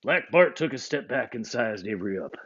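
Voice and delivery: usual speaking voice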